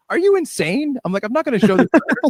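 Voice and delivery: retold as a frantic high refusal; incredulous outburst. Refusing to show the video to his boss, high and frantic. Retold panic at Kevin's request.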